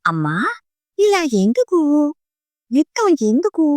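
a hint of a pleading little girl; child's question. A narrator's reading. Child asks mother.

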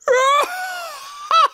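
big, high roar of pirate laughter; Captain Blackheart's roaring laughter. A narrator's reading. Pirate captain mocks the boy, roaring with laughter. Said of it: "and then roared with laughter".

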